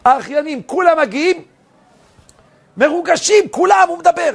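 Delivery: high-pitched, swelling excitement; excited telling. Retold or imitated. Preacher telling how relatives all arrive moved, excited telling. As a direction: build to an emotional high cry.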